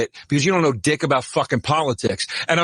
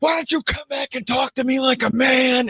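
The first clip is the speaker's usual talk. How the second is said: retold angry yelling; 10 semitones higher than usual. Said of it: "he's going".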